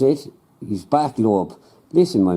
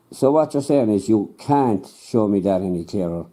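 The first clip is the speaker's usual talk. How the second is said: ordinary delivery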